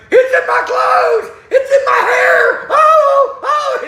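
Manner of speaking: slow, high hysterical shriek; screaming like a girl. Retold or imitated. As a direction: wail it at full volume, girlishly. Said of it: "He ran off screaming like a girl".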